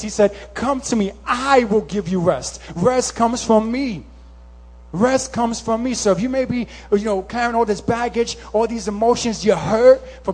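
urgent pulpit exhortation; impassioned preaching. Real, not acted. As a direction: preach it out with force, hitting key words hard. Preaches at full force.

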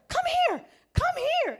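high, loud call for help; urgent shout. Retold or imitated. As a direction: yell sharply to summon someone over. Daughter yelling for help from water.

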